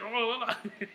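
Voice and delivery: loud laugh. Real, not acted. Laugh after bartender quote.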